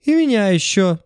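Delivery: high, shrill hysterical woman. A narrator's reading. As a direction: cry out like a frantic woman. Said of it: "истеричный женский голос".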